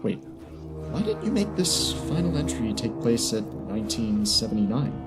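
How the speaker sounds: usual speaking voice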